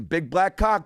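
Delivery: usual speaking voice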